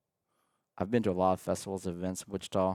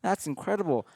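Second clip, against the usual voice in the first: amazed; 10 semitones higher than usual. Retold or imitated. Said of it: "I'm like".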